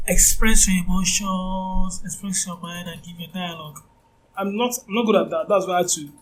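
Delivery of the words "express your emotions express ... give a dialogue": nose pinched, speaking through the nose; nasal voice. Shown as a demonstration. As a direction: cover the nose and talk. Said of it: "cover my nose to try nasally".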